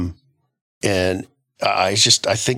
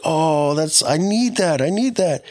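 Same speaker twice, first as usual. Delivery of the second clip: high mock swoon over a guy; hammed-up girl gushing; mock girly voice; 8 semitones higher than usual. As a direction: squeal it like a smitten girl. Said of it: "some of you girls are like".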